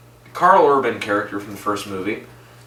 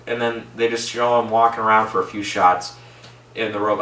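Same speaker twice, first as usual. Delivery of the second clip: ordinary delivery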